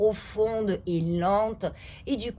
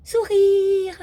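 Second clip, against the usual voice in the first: higher, louder, said through a big smile; smiling; 11 semitones higher, 7 dB louder than usual. Shown as a demonstration. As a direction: grin as you say it. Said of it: "C'est le". (dit avec un grand sourire, plus aigu et plus fort)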